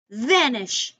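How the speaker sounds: a loud firm vanish; commanding. Acted. Stick ordering Pine Cone away, a loud vanish.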